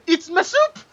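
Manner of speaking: high, loud mock crying; distraught diner. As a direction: wail in hammed-up despair. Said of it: "he's like".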